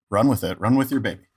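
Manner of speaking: ordinary delivery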